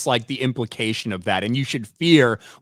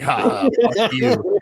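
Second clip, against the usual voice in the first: mock orc sneer; 8 semitones higher, slower than usual. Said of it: "the orc would be like".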